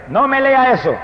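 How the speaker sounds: wife's objection, pitched high; high retold protest; imitating loud protest. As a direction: raise the voice, mimicking an objecting wife. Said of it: "en voz alta".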